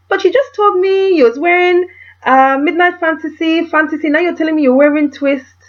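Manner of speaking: high, loud incredulous protest; baffled shop lady. Retold or imitated. Nigerian shop assistant confused about which perfume, loud disbelief. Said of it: "I was gonna put on a Nigerian accent".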